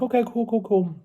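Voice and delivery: breezy okay. Retold or imitated. Imitating the new helper's easygoing okay. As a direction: toss off the okays lightly and airily. Retelling the helper's casual reply.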